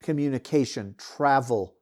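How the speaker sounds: normal talking voice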